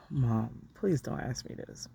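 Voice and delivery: sigh, then softly plead; hushed, reluctant plea after a sigh; quiet exasperation with a parent; weary plea. Retold or imitated. Retold weary plea to mother.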